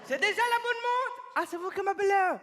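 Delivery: high surprised questions; answering an unexpected call, surprised and high; surprised phone answer. Acted.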